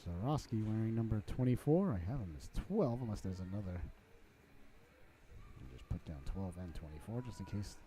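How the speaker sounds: very soft, low mumbling aside; hushed, low note-to-self; talking to himself. Real, not acted. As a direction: think aloud quietly over the roster. Muttering over his roster to himself.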